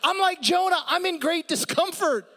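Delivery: high, loud mock panic; hammed-up whining; comic panic. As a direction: squeal in exaggerated disgust. Comic rant about the worm.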